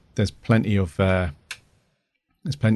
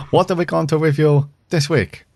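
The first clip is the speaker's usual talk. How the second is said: raised, louder showy question; mock grand announcer; 6 semitones higher, 3 dB louder than usual. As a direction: ham it up like a presenter. Teasing host question.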